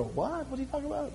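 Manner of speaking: high, very soft mock child voice; puzzled child. Preacher voicing children confused by his words, small high voice. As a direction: imitate a puzzled kid in a small, high, hushed voice. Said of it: "Some of the children go".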